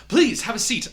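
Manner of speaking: loud, high, eager please; booming invitation. Acted. Douglas insisting the doctor take a seat, loudly. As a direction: press her to sit, overjoyed. Host urging guest to sit.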